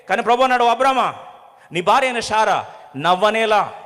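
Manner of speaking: loud, high preaching with rising fervour; full-force preaching. Acted. A preacher challenging the congregation's doubts, loudly. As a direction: drive the warning home to the congregation.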